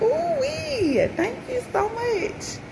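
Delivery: high, slow delighted squeal; high slow joyful ooh-wee; overjoyed thanks. Retold or imitated. Joyful thanks retold as quote.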